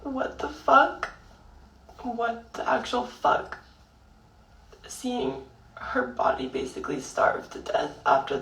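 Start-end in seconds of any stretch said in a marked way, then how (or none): 0.0-3.6 slow, high, stunned "what the fuck"; shocked disbelief. Retold or imitated. Granddaughter reacting to the nurse, stunned high slow disbelief. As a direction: let the outrage rise in disbelief. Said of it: "And so I'm like".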